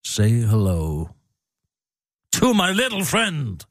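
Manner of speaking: movie gangster quote. Retold or imitated. Quotes Scarface mid-discussion.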